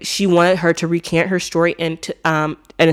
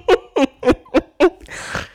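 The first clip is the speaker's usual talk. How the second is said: high-pitched helpless giggle; laughing; 10 semitones higher, 3 dB louder than usual. Real, not acted. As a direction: burst into a high, real laugh. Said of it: "I'm laughing because".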